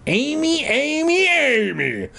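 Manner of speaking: high, drawn-out calls of her name; haunt her with each call; eerie calling. Acted.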